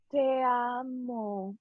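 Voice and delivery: put-on baby's first words stretched out very slowly. Baby son saying his first words to mom, very slow. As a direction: drag each syllable out like a baby learning to talk. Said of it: "like, very slow".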